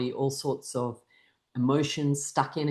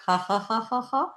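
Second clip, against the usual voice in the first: higher ha-has climbing steadily; short rising practice laugh; exercise laughter; 7 semitones higher than usual. Shown as a demonstration. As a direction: laugh on purpose while miming. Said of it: "Make sure you laugh with every activity".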